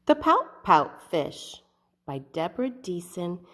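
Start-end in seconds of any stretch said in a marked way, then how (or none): 0.0-1.6 slow, loud announcing of the title; storybook title read out slowly and loudly; title read aloud. Shown as a demonstration. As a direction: proclaim the book's name to the kids. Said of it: "I'm gonna zoom in".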